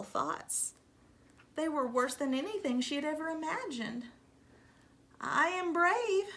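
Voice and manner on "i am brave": higher, slow, wavering try at courage; unsure self-reassurance. Acted. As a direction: talk yourself into it. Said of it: "tried to convince herself".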